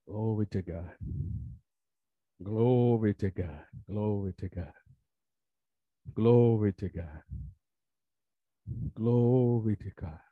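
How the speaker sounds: low, slow murmured praise; reverent worship. Real, not acted. Preacher praising God as service closes, reverent. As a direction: let each repetition sink low and unhurried.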